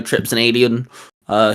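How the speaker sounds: ordinary talk, nothing marked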